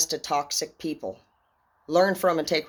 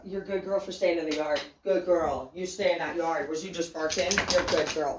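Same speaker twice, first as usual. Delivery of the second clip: praising the dog. Real, not acted.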